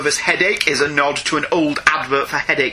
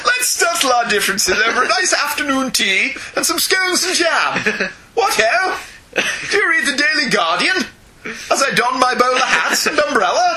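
high, hammed-up English gentleman; plummy teatime parody with swooping pitch; mock upper-crust British; 7 semitones higher than usual. Villain offering Spider-Man afternoon tea, mock upper-crust British. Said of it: "kind of voice, can't you?".

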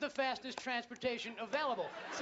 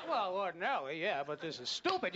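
much lower, dopey-serious answer; mock-patient explainer; 7 semitones lower than usual. Filmmakers answer the puzzled viewer, mock-patient explaining. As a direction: drop low to explain it away.

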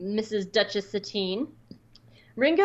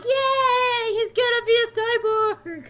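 very high mock squeal of delight; gleeful cheer; 14 semitones higher, 4 dB louder, slower than usual. Host imitates friend thrilled about a cyborg villain, gleeful. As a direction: imitate an overjoyed fan, sustained high pitch.

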